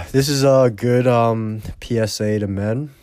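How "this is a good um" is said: ordinary talk, nothing marked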